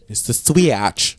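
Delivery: very slow, "switch" stretched into swee-atch; mocking pronunciation. Host mimicking co-host, "switch" drawn out as swee-atch. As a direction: drawl "switch" into two syllables. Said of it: "You just say".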